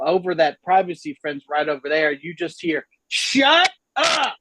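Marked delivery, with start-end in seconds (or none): 3.1-4.3 high, drawn-out neighbor's yell; yelling at dog. Retold or imitated. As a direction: holler it over the fence at barking dogs. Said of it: "when you yell at a dog".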